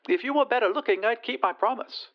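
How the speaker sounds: very high lady's voice; haughty lady. Acted. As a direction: turn him down in high, dainty tones. Lady rejects her suitor.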